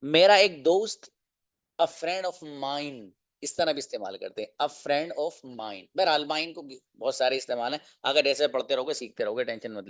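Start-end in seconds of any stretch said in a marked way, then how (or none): 1.8-3.1 a friend of mine stretched slowly, softer; slow model phrase. Shown as a demonstration. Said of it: "teacher models phrase slowly". 4.7-5.8 a friend of mine drawn out slowly, softer; slow model phrase. Shown as a demonstration. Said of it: "teacher models phrase slowly".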